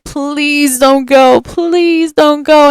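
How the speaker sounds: slow, drawn-out imitation of begging; mock pleading. Boy begging her to stay.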